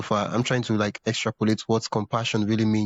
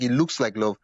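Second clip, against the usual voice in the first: normal talking voice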